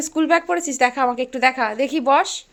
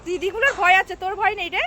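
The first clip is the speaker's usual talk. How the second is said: usual speaking voice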